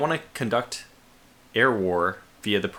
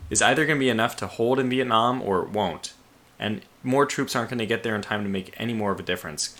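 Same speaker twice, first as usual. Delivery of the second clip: ordinary delivery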